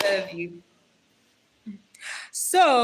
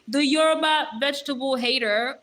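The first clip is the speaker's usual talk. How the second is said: loud, raised playful summons; weird roll call; 5 semitones higher, 4 dB louder than usual. Real, not acted. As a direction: announce the names like an over-the-top register. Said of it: "that sounded so weird".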